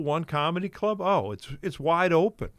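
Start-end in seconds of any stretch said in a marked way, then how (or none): none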